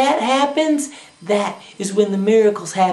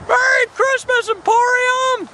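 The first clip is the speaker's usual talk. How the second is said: crazed elation; 12 semitones higher than usual. Acted. George running past the town's buildings, crazed elated shouting. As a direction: holler greetings at every building. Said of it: "And then he's like".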